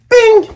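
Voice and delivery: high, loud ping; slingshot ping. Retold or imitated. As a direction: fire the shot sound. Slingshot sound effect.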